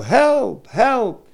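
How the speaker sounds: high, urgent cry for help; mild mock yell, pitched high; retold yell for help. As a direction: call out to the passing jeep for help. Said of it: "and I yell".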